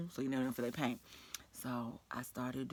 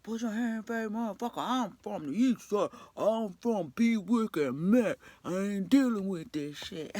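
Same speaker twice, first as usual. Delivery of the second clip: louder and higher, slow, tough street boast; mock threatening coworker; 7 semitones higher, 9 dB louder than usual. Coworker threatening the narrator by naming her neighborhood, loud mock tough talk. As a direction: puff up like she's ready to fight.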